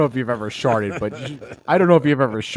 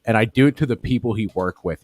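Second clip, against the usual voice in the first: usual speaking voice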